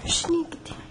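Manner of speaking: whisper. Acted. Whispering again.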